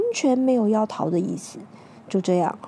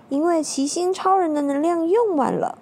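usual speaking voice

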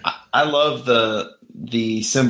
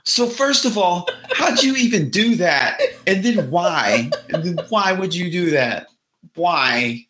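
exasperated Toland; 8 semitones higher than usual. Retold or imitated. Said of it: "He's like".

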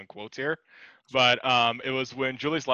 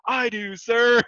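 mock hero reply; 9 semitones higher, 4 dB louder, much slower than usual. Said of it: "somebody will come in".

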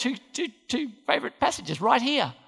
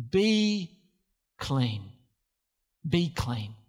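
much lower, soft and calm; low gentle command; 6 semitones lower, 4 dB quieter, much slower than usual. Retold or imitated. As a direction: heal him with a low, quiet word. Jesus heals leper, low.

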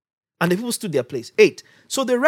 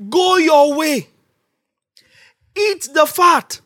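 proclaiming; 5 semitones higher, slower than usual. Retold or imitated. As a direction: announce the feast, slowly and high. Nehemiah's proclamation quoted.

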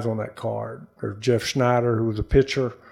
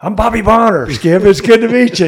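higher and louder, eager to please; eager rookie greeting; 10 semitones higher, 10 dB louder than usual. Retold or imitated. Rookie re-introducing himself to the manager, eager and loud. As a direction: call it out brightly again.

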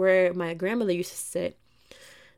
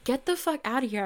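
stunned disbelief; 6 semitones higher than usual. Retold or imitated. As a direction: blurt it out, floored by the hit. Psychic reading hit confirmed.

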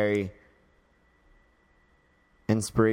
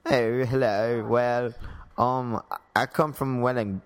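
an attempted English accent; British accent. Shown as a demonstration. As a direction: hesitantly put on a British accent.

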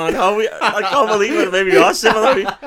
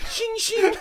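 high sung shing of a blade; sword ring sound effect. Pretend. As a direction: ring out like drawn steel. Imitating a sword ringing.

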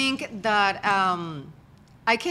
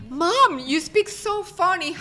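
light, higher mock kid voice quoting her daughter; daughter's voice; 7 semitones higher, much slower than usual. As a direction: tease like a little girl. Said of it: "And she tell me".

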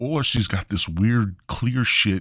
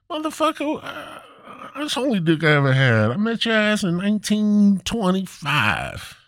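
put-on old grandma retort; 12 semitones higher than usual. Old grandma mocking her husband in bed, high and sassy. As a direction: fire back as an unimpressed old wife.

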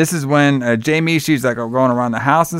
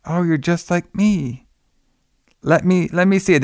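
put-on child voice; 5 semitones higher than usual. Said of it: "then she's like".

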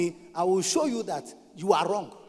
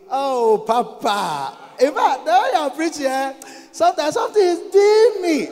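hammed-up admirer, rising high and louder; mock gushing flattery; 4 semitones higher, 5 dB louder than usual. Member flattering papa about his preaching, mock gushing. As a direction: gush at him, building to put-on overwhelm. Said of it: "when you come in front of him, you say".

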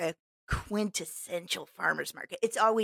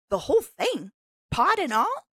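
louder, rising disbelief; can't believe it; incredulous; 6 semitones higher, 6 dB louder than usual. Retold or imitated. Retelling her disbelief at eating the pod.

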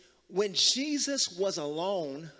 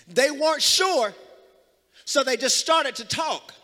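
full-force preaching; 5 semitones higher, 6 dB louder than usual. Real, not acted. Preacher declaring the verse to the congregation, full-force preaching. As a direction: proclaim the scripture, hammering each phrase.

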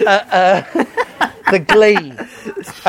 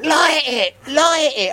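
higher-pitched imitation of a heckler; mock heckler yell; 7 semitones higher, slower than usual. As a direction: egg someone on in a mock yell. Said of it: "the bloke that had been screaming".

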